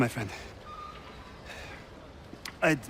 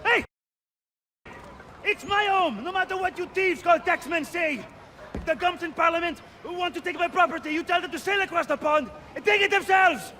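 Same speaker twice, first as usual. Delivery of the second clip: much higher, defiant shouting at the taxmen; defiant yell; 7 semitones higher than usual. Acted. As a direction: yell them off your doorstep. Man yells at tax collectors.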